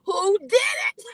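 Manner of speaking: rising to a high, loud cry; mother demanding who hurt her son; frantic demand. Retold or imitated. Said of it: "and I said".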